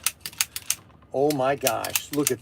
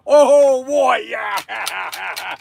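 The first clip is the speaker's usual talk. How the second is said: excited shout; 2 semitones higher, much slower than usual. Real, not acted. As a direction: whoop with joy as the caps go off. Firing the cap gun.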